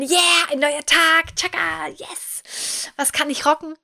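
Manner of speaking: overly peppy morning motivation voice; hyped morning self. Pretend. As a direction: play the cheering early riser shouting tschakka. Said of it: "die morgens aus dem Bett springt".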